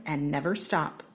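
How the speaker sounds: usual speaking voice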